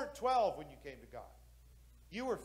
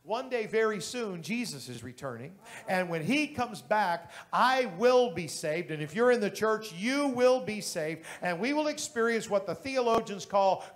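building preaching; 7 dB louder than usual. Acted.

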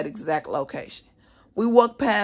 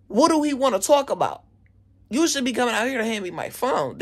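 indignant, retold; 5 semitones higher than usual. Angry retort when summoned, retold.

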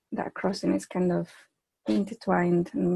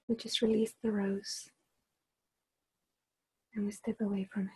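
measured meditation voice with long, unhurried pauses; guided meditation. Acted. Leader guiding a group to extend their awareness, guided meditation. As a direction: guide them gently inward, phrase by phrase, softening as it goes.

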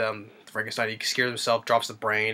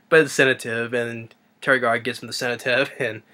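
normal talking voice

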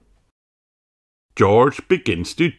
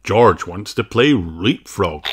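ordinary delivery

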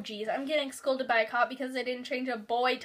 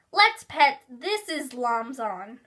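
put-on slow, loud excited kid voice; 6 dB louder, much slower than usual. A narrator's reading. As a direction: eagerly stretch out every word. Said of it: "Justin said excitedly".

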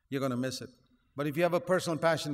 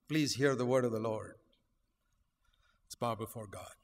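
ordinary talk, nothing marked

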